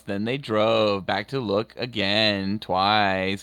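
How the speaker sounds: slow, drawn-out narration with misplaced emphasis; odd narrator impression. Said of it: "putting the emphasis on the wrong syllable".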